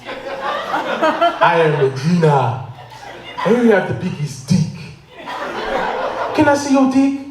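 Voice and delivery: put-on slow, stilted foreign-accented woman; film actress impression. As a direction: play a bad movie actress, drawn out. Acts out woman in African film.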